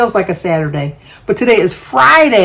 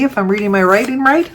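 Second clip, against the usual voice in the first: normal talking voice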